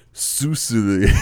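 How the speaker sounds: put-on foreign-sounding word; mock Creole. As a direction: say it like a fake foreign phrase. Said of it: "He'd be all like".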